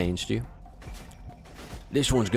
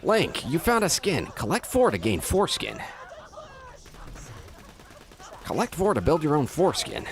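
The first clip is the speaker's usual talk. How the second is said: announcer voice; 8 semitones higher than usual. Acted. Game announcer parody, laughing.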